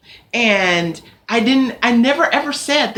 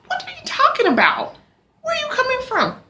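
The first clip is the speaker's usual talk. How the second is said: high, disbelieving questions; incredulous; faster than usual. Retold or imitated. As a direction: pitch way up in disbelief. Incredulous reaction to attacker.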